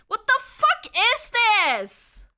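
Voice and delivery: high, loud, slow what the fuck; real incredulous cry; stretched disbelief. Real, not acted. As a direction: drag it out in shock. Confused by the cables.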